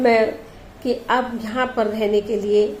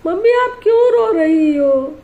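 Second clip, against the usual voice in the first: high, louder upset question; distressed child; 6 semitones higher, 4 dB louder than usual. Acted. Daughter asks her crying mother why, high and upset. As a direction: ask through rising distress.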